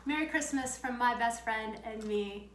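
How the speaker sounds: normal talking voice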